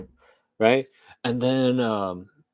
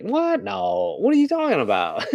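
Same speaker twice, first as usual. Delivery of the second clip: much higher mock squeaky disbelief; incredulous Vance; 10 semitones higher than usual. Vance answers Theo, high mock disbelief. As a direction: play him flustered and caught off guard. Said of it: "J D Vance is like".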